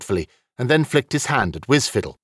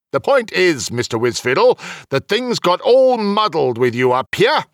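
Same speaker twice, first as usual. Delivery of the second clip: raised-pitch character voice, firm; otherworldly official setting someone straight; official rebuking a wizard; 8 semitones higher, 3 dB louder than usual. Acted. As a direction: make the point as an exasperated official.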